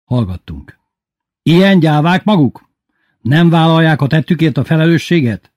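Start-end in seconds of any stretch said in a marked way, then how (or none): 1.4-5.5 high, loud scolding; berating headmaster. A narrator's reading. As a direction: shame the cowards loudly. Headmaster berating silent class. (felháborodott, hangos szidás)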